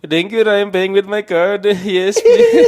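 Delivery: high, polite mock Indian accent. Paying at the drive-thru window, put-on Indian accent. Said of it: "start off with the Indian accent".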